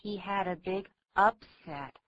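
"upset" stressed on UP; stress on first syllable. Shown as a demonstration. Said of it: "重音就在第一个音节".

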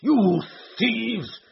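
high, loud raging threats; enraged man shouting threats, slowing on the last words; furious shouting. A narrator's reading. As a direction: scream threats at the intruders. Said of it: "he shrieked".

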